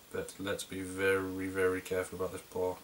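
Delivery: soft, slow and careful; careful concentration. Real, not acted. Reviewer talking through a delicate beer pour, careful and hushed. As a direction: ease the words out slowly and softly while concentrating.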